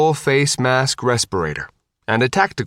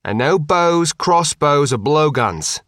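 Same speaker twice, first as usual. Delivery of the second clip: raised-pitch call after her; calling after someone; 5 semitones higher, slower than usual. A narrator's reading. Tom warns his departing niece about weapons, calling after her. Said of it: "Tom called after her".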